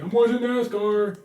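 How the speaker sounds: lightly voiced grumpy dad; a hint of a dad in his recliner; imitating dad. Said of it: "be like".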